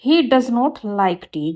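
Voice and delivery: slow, careful read of the corrected sentence; slow teacher dictation. Shown as a demonstration. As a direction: lay out each word clearly for the class. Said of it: "ये बनेगा".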